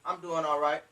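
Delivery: low, soft, lackluster answer; unenthusiastic. Shown as a demonstration. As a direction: answer with no energy at all. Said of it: "Don't say".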